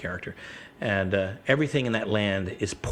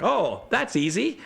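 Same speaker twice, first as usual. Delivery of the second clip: cocky; 10 semitones higher, slower than usual. Retold or imitated. Self quoted, cocky.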